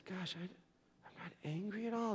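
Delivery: soft, musing aside; said to himself. Retold or imitated. Husband searching himself about his anger, soft self-talk. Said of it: "to, to himself".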